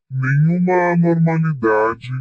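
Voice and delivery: ordinary delivery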